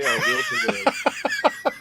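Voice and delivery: real laughter asking the chat; laughing. Real, not acted. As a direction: giggle at the dark question. Laughs reading the grim choice.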